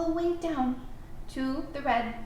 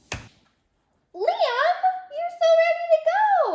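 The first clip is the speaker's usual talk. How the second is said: very high, loud sing-song praise; excited praise; 7 dB louder, much slower than usual. Real, not acted. Teacher cheering the child on, excited high praise. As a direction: cheer him on.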